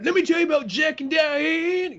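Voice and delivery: high put-on rock singer voice, hammed up. Blaring the radio in the Hummer, mock rocker voice. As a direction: croon it like a washed-up rocker plugging his hit. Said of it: "Is that, is that [name]?".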